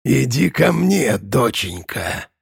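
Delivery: a monster faking a father's tenderness; creepy tender monster. Acted. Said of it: "с пугающей лаской в незнакомом голосе".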